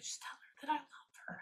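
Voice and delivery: relayed in a hushed whisper. Retold or imitated. Psychic relaying his words in a whisper. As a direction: breathe it like a secret message. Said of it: "He's like".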